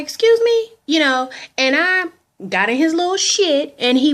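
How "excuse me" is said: high, loud offended snap; sassy excuse me. Retold or imitated. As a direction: put him in his place, pitched way up. Telling off the man she dated.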